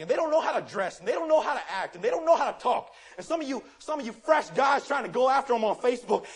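loud, driving sermon delivery with rising heat; full-force preaching. Acted. Pastor scolding his young congregation, preaching loud and hard.

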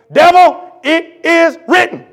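high, loud, slow shout at the devil; shouted rebuke. Acted. Jesus rebuking the devil, a high slow shout. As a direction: fire the words back like a blow. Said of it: "you know what he shot back at him?".

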